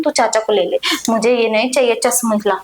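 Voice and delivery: ordinary talk, nothing marked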